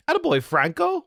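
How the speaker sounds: high, loud cheer for a donor; excited shout-out. Real, not acted. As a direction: cheer the name out. Reading chat donation aloud, excited.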